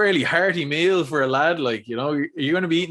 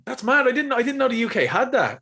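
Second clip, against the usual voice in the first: genuinely surprised; 10 semitones higher than usual. Real, not acted. Surprised at UK spice bags.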